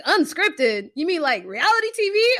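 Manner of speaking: retold disbelief. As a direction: replay your doubt, building to a high finish. Retold disbelief at reality show trailer.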